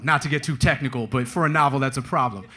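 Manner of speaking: ordinary talk, nothing marked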